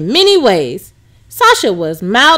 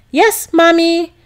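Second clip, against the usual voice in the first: put-on higher child's reply; lightly voiced little girl; 6 semitones higher, slower than usual. A narrator's reading. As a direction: answer sweetly like a small girl. Daughter voiced.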